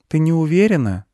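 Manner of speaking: put-on high boy's question; high-pitched young boy asking gently; little boy. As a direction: ask in a high child voice.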